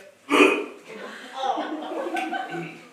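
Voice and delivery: high, loud derisive laugh; scornful laugh. Acted. Lysander mocking the thought of Hermia, loud derisive laugh. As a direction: laugh the idea away.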